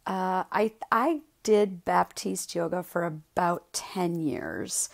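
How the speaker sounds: normal talking voice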